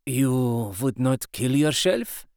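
slow, hoarse and hushed question; hoarse shocked question to Mina. A narrator's reading. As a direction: ask it slowly with a rough voice. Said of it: "he asked hoarsely".

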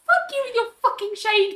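high-pitched indignant retort; mock indignation. Fan telling off a shady character, high mock outrage. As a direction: squeal the insult in playful outrage.

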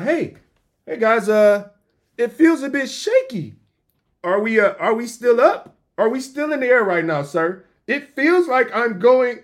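high, loud mock jitters, slowing on the last line; a jumpy flyer pleading for reassurance; nervous passenger. Passenger asking the pilot about turbulence, high mock nervousness.

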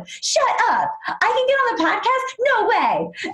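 very high, incredulous fangirl imitation; excited disbelief. Fan is invited onto the podcast, excited. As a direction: act out a fan bowled over by the offer. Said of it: "she was so excited to meet me".

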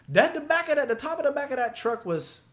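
high, loud stress on the size; emphatic. Real, not acted. Telling friends about the truck's height, emphatic. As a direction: marvel at how tall it was.

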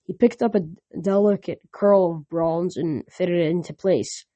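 ordinary delivery

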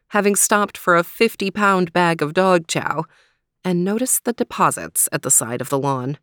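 ordinary delivery

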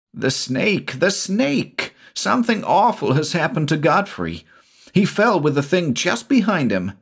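high-pitched, frightened report; panicked gasping. A narrator's reading. Shula telling Avilton that Godfrey fell, panicked and high. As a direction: pour it out in panic. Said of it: "Shula gasped".

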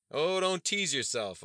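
loud, high, drawn-out bellow. A narrator's reading. Undercover activist rebuking a student leader, bellowing. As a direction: bellow it to pull in a crowd. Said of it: "Elijah bellowed".

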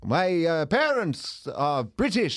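put-on slow, Dracula-like foreign accent; hammed-up Eastern European-style accent; comic old-man accent. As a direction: draw the words out in a mock heavy accent. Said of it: "are you playing Dracula".

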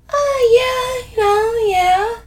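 high-pitched noncommittal mumble; mumbled. A narrator's reading. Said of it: "I mumble".

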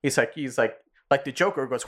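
ordinary talk

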